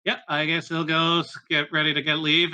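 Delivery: ordinary delivery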